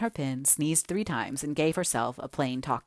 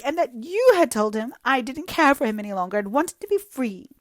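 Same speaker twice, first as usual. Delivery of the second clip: high, loud, self-pitying lament; jilted girl pouring out woe to Anne; melodramatic young woman; 10 semitones higher, 6 dB louder than usual. Acted. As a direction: wallow in every word like a tragic heroine.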